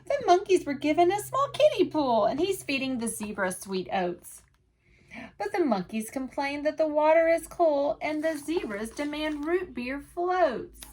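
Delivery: lively picture-book read-aloud in rhyme; sing-song storytime voice for little listeners; reading to children. A narrator's reading. Teacher reading a rhyming book to children, lively.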